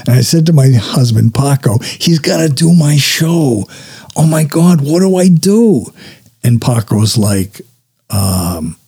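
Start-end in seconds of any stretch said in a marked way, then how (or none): 1.8-5.8 high-pitched mock freak-out; flustered, voice climbing; retold panic. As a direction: exclaim it in rising alarm. Retold panicked call to husband.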